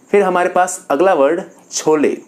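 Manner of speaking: ordinary talk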